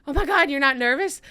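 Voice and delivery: high, loud nervous outburst; scared disbelief. Retold or imitated. Scared flyer to her sleeping cameraman, alarmed disbelief. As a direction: blurt it at someone far too calm. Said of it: "but I'm, like, scared".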